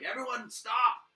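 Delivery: high, loud and slow command; sergeant's shout. Acted. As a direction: call out to halt everyone. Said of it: "Sergeant Markguth that's down there kind of like".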